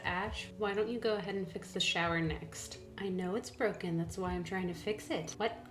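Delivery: normal talking voice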